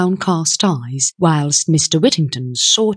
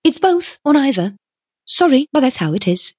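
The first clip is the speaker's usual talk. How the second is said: high-pitched, decisive farewell; plucky young woman; 8 semitones higher, faster than usual. A narrator's reading. Young woman refuses businessman and leaves, decisive. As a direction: end it briskly in a high voice. Tuppence takes her leave.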